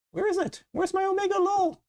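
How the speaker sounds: very high, exasperated squeal; frantic searching. Real, not acted. Streamer hunts for a missing emote, frantic. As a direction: wail it high, hunting for something lost.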